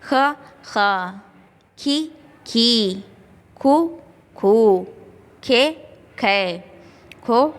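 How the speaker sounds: each syllable said clearly, one at a time; reciting the barakhadi. Shown as a demonstration. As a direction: drill ख, खी, खू, खे, खो as a teacher. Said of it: "अब ख की बारह खड़ी कैसे बनाना है?".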